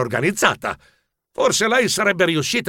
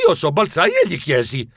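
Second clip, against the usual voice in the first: plain reading voice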